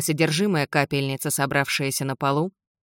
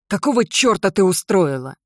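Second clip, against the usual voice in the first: loud, higher angry demand; a father furiously demanding an explanation; spat out; 4 semitones higher, 5 dB louder than usual. Acted. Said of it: "выплюнул Джим". (гневно выплёвывает)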